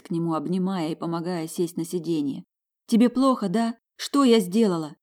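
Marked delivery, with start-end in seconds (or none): 2.9-5.0 high, loud scared questions; frightened. Acted. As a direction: ask in rising panic. Frightened question to wounded Ruslan.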